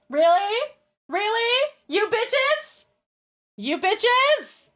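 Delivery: high, rising, incredulous outrage; indignant disbelief. Real, not acted. Streamer censored by her chat, high-pitched indignation. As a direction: squeal it in offended disbelief.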